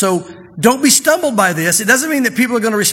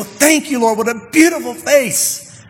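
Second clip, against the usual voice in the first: higher, slow, drawn-out exclamation of wonder; mock-awed worshipper, pitched up; acted adoring praise; 4 semitones higher, slower than usual. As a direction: marvel aloud, stretching the praise out. Admirer marvels at God's loving face.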